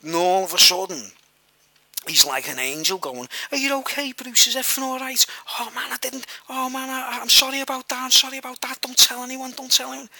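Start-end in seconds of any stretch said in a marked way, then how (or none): 3.5-10.1 high, pleading, lightly voiced near-crying apology; near tears, imitated. Attacker begging his friend not to tell, mild mock tearfulness. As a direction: play a man close to tears begging forgiveness. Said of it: "he was almost crying".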